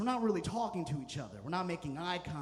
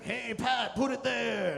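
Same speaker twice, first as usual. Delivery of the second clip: grandpa voice; 5 semitones higher, 4 dB louder, slower than usual. Retold or imitated. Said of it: "He's like".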